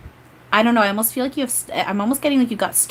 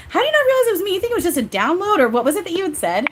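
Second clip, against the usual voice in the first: high-pitched mock indignation, easing down by the end; playfully offended; 11 semitones higher, 3 dB louder, faster than usual. Medium voicing Eric about being seen, playfully offended. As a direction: protest in teasing, exaggerated disbelief. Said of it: "He's, like, playfully offended".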